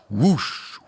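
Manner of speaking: ordinary delivery, nothing marked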